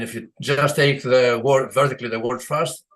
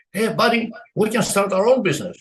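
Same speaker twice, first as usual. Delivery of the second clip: a lightly voiced chicken, higher and eager; chicken's eager pitch; 8 semitones higher, 3 dB louder than usual. Chicken to pig, pitching a business: lightly voiced, high and eager. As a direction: sell the pig on the idea, chipper.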